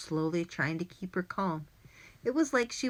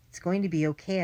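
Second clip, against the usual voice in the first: usual speaking voice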